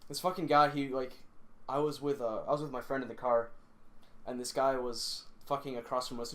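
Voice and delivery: usual speaking voice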